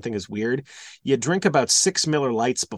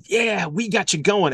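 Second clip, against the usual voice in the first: higher-pitched radio-style hype; pumping upbeat; 7 semitones higher than usual. Retold or imitated. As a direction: imitate an upbeat pump-up song opener. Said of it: "pumping upbeat".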